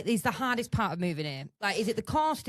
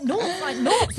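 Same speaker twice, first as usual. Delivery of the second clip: higher-pitched insistence, retold; exasperated repeating; 7 semitones higher, 4 dB louder, slower than usual. Shopper repeating NUTS to a puzzled shop worker, exasperated. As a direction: repeat it as if he should understand. Retelling repeated failure to be understood.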